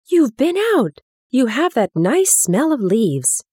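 put-on high, eager cry of a delighted boy; lightly voiced boy calling out happily; excited call. A narrator's reading. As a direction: call out with sudden joy. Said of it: "he called out".

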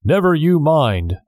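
high-pitched guard's retort; mildly defiant comeback; sharp retort. A narrator's reading. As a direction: brush off a stranger curtly. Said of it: "the guard retorted".

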